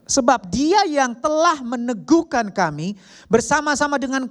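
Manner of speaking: high, loud scripture declamation; preaching at full force. Real, not acted. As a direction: ring the verse out with long, held high notes. Preacher declaims the Bible verse.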